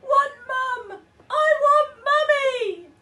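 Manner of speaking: high, loud, slow wailing tantrum; crying toddler. A narrator's reading. Baby carried off, wailing tantrum. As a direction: wail it like a sobbing toddler.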